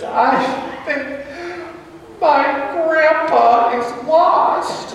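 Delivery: put-on high, slow voice of a small girl about to cry; trembling child, drawn out and high; tearful little girl. Lost girl tells strangers her grandpa is lost, high and tearful. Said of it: "her lower lip begins to quiver".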